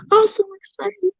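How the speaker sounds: high, teary and emotional; happy tears breaking through; weepy excitement. Real, not acted. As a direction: choke up with joy. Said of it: "I'm not trying to get weepy".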